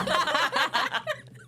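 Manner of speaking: real laugh jumping way up; high burst of laughter. Real, not acted. Friend teased about meeting French people, laughing. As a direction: laugh out loud at the worry.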